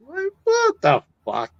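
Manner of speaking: high, disbelieving what the fuck; incredulous outburst. Real, not acted. Ranting about pricey shows, disbelieving high outburst. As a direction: toss it up in disbelief.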